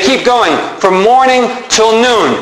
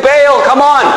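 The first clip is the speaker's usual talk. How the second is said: pleading call; 6 semitones higher than usual. Acted. Wounded prophets begging Baal.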